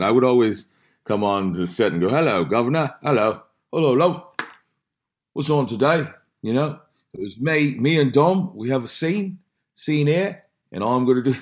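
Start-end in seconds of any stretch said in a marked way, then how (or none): 2.1-6.0 high, teasing British greeting; mock British accent. Actor teases his British co-star on set, mock British accent. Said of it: "he would tease me about my British accent". 6.4-11.4 high-pitched British impression; mock British accent. Actor sends up his co-star on set, mock British accent. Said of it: "he would tease me about my British accent".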